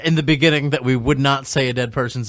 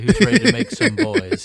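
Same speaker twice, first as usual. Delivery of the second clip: high, cheery delivery-room doctor; doctor delivering baby; 8 semitones higher than usual. Pretend. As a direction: play the jolly obstetrician. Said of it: "I'd say".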